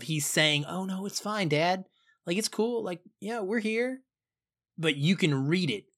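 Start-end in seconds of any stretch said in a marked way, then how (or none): 0.7-4.0 imitating fake breeziness, higher; forced casual. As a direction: pretend it's all fine, up high and light. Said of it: "that complete feeling of".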